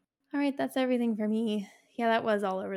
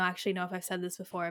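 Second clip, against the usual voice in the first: ordinary talk, nothing marked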